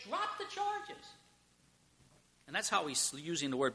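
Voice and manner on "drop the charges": very high, soft, strained plea; hushed urging. Acted. What Pilate wants the crowd to say, hushed urging.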